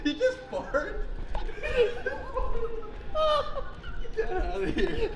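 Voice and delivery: high, cracking up laughing. Real, not acted. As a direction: squeeze words out through laughter. Laughing fit over a fart.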